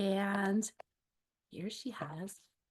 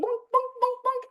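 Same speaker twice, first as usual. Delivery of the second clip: high, loud bouncing bonks; bonk sounds; 12 semitones higher, 4 dB louder than usual. A narrator's reading. Reading to kids, the egg bouncing along. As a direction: bounce each bonk like a ball.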